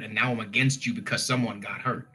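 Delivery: normal talking voice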